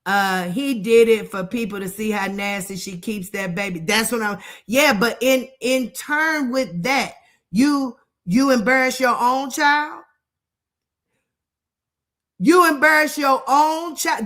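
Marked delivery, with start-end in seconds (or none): none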